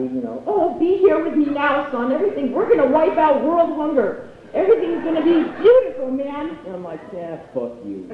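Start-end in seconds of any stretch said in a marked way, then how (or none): 0.5-6.6 high, gushing mock mother voice; blissed-out hippie mom. Mom greeting her son, high hammed-up happiness. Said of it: "my mom is all S happy". 7.1-8.1 low, quiet brush-off; deadpan dismissal. Retold or imitated. Son deflating his happy mom, low deadpan. Son's curt reply to gushing mom.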